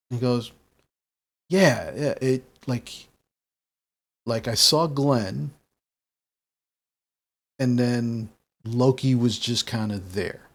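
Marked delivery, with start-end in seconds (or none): 1.5-10.5 halting, with long pauses; thinking it over. Acted. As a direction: piece the memory together as you speak. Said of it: "kind of thinking about it".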